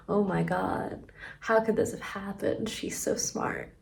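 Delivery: hushed, awestruck mock aside; mock awed hush. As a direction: murmur it in mock amazement. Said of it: "he's like".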